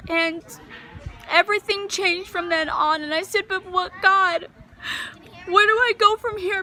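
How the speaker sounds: high, emotional voice straining with feeling; choked up. Real, not acted. Mother asking God why these things happened, choked up. Testimony overcome after recalling God's love.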